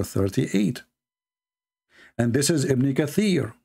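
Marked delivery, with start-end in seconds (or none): none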